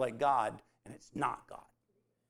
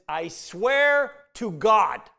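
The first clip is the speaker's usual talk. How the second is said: swearing oath; 6 semitones higher, 11 dB louder, much slower than usual. Retold or imitated. Peter denies knowing Jesus.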